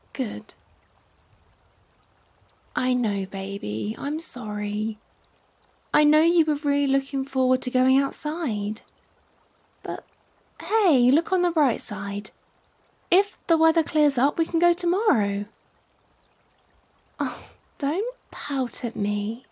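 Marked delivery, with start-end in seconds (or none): 0.1-5.0 quiet, slow soothing apology; soft comforting. Acted. Consoling a disappointed partner, quiet and tender. 17.2-19.4 soft, slow coaxing; tender teasing. Acted. To a pouting partner, soft and slow teasing.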